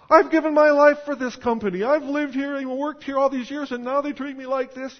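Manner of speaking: high, loud, indignant whine; mock the outraged long-time employee; fired worker. Preacher imitating a fired loafer protesting to the congregation, high and indignant. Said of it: "what's the first thing that they say".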